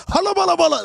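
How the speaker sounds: high, silly gibberish imitating fake Arabic. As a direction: mimic a guy faking Arabic noises. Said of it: "He just makes noises".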